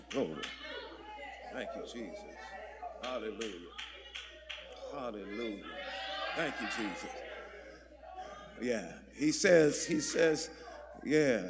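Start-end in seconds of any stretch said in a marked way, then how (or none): 0.1-8.9 very soft, low worship murmur; murmured praise. Real, not acted. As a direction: barely voice it, as private thanks to God. Overcome, murmuring praise to God.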